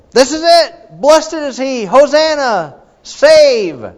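high, loud, jubilant crowd cry; excited crowd shout. Retold or imitated. Crowd hailing Jesus entering Jerusalem, shouting jubilantly. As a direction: shout it like the cheering crowd at the gate.

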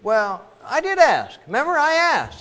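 high, loud whining complaint; whiny protest. Acted. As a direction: whine it like a child insisting he asked. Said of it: "He said".

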